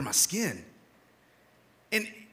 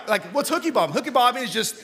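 usual speaking voice

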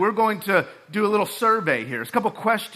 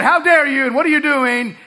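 higher, louder mock rage; ballistic; 7 semitones higher, 6 dB louder than usual. Squad leader shouting at a cadet, mock rage. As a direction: blow up at the cadet. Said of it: "he went ballistic on me".

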